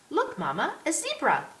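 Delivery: put-on very high, loud little-girl cry; excited little girl. A narrator's reading. Little girl points out the horse to Mama, excited high child voice. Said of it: "a small, curly-haired girl".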